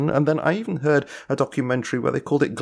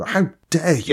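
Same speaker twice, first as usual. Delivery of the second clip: sharp, higher, indignant rebuke; retold outrage; 6 semitones higher than usual. Lord Monckton rebuking an audience member, outraged. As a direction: throw the outrage back at the questioner. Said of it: "And he was outraged".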